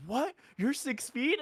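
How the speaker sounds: mock shocked kid; surprised child. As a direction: gasp in disbelief at six feet. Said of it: "then she's like".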